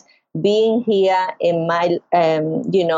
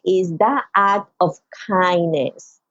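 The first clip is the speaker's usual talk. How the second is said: ordinary talk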